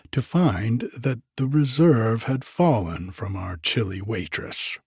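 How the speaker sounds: plain reading voice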